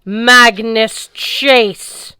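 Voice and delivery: very slow, loud, drawn-out boom. Acted. Fire Lord booming Magnus's name across the battlefield. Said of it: "Cert's voice boomed".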